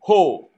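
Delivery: Ho with a clear breathy h before the o; aspirated ho. Shown as a demonstration. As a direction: breathe out an h, then say Ho. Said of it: "ditambahin huruf h".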